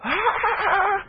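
a high, squealing laugh-scream; mock kid's scream, very high; imitated child scream. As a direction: mimic a toddler's shriek, half laughing. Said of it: "he lets out this scream".

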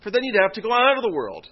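imitated disbelief, pushing up in pitch and volume; incredulous. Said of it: "Paul speaks pretty incredulously here".

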